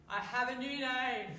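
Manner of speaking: loud, high, slow mock Scottish voice; playful put-on Scottish accent; hammed-up Scottish brogue. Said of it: "I'm not very good at the Scottish accent".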